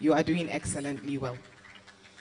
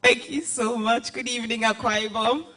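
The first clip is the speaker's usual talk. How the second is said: excited greeting; 6 semitones higher, 4 dB louder than usual. Real, not acted.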